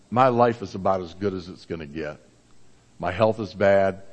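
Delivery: low-pitched, resigned retelling; subdued resignation. Retold or imitated. Father retelling his ailing son's realization, subdued and resigned. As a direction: quote him quietly resigned, voice dropped.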